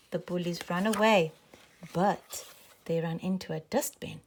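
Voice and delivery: ordinary delivery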